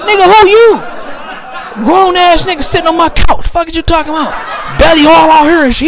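high, laughing outrage; laughing disbelief at a seven-year-old with a beer belly; incredulous outrage. Retold or imitated. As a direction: squeal at the grown-looking kid. Retells stunned outrage.

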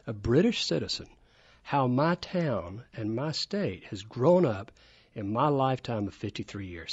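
ordinary talk

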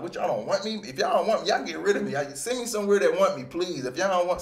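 retelling his holdout frustration at the organization; frustrated rant. Retold or imitated. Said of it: "a little mad, a little frustrated".

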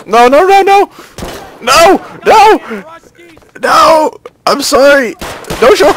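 high, loud shrieks of panic; real frantic, high-pitched yelling; panicked screaming. Real, not acted. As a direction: scream pleas as you're attacked. Under attack in game.

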